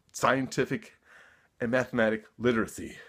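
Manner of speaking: usual speaking voice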